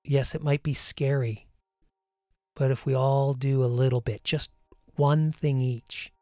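calm meditation voice over music, unhurried; guided meditation. Acted. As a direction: ease the listener into breathing and calm.

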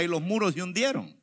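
ordinary talk

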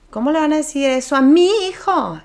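high, loud indignant outburst; offended parent. Retold or imitated. A possessive parent protesting an insult to their child, indignant and loud. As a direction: bristle like a parent whose child was insulted.

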